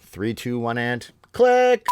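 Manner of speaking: host's signature countdown to the click; countdown sign-off. Shown as a demonstration. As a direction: stretch the final click for the viewers. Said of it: "Are you ready?".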